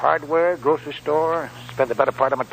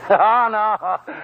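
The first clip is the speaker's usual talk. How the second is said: laughing; 6 semitones higher, 4 dB louder than usual. Acted. Old man laughs off insult.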